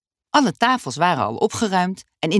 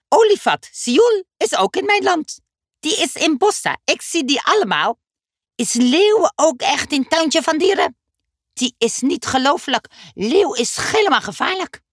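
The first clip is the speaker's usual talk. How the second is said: put-on much higher, eager little boy; Dummy's high child voice; 11 semitones higher, 4 dB louder than usual. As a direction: squeak it out like an impatient kid. Dummy's voice in story. (hoge kinderstem, ongeduldig)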